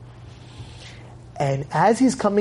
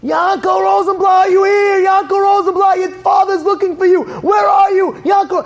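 high, sustained searching shout; loud, high calling out for someone missing; megaphone scream; 12 semitones higher, 4 dB louder than usual. Acted. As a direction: scream the name out across a crowd. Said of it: "he screams out".

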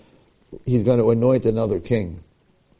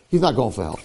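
usual speaking voice

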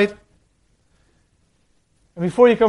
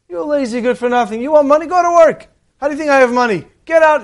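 higher and louder, building angry shout; furious rant; 7 semitones higher, 4 dB louder than usual. Acted. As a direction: tear into the lazy brother and throw him out. Rich brother throws him out.

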